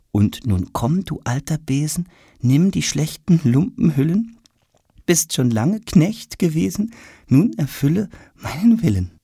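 measured verse with lifted pitch; poem recitation. A narrator's reading. Tom reads the ballad from his schoolbook, reciting.

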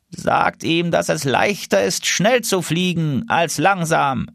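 a hint of an old lead goose, slowed; lightly voiced old goose giving advice. Lead goose calling back advice, lightly voiced. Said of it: "rief die Führergans".

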